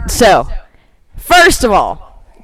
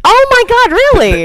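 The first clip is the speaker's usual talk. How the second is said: high, loud astonished outburst; real surprise; 11 semitones higher, 4 dB louder than usual. Real, not acted. Co-host learning about the actor from three hundred, astonished. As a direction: burst out amazed, pitch leaping up.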